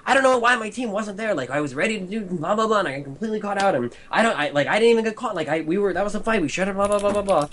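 high whiny mock excuse voice; high, petulant excuse-making; mocking whiny streamer. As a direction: whine like a streamer blaming his team.

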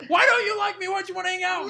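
high-pitched needy whine; imitating a desperate, jilted pursuer; crazy ex-girlfriend. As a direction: plead shrilly like a clingy ex. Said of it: "crazy ex-girlfriend status".

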